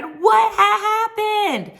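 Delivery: high, loud disbelieving cry; host shocked by the ending; shocked cliffhanger. Real, not acted. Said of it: "I said".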